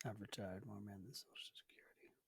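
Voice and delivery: whispered under the breath. Real, not acted. Guest whispers the quote to himself, testing it. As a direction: try the line out in a whisper.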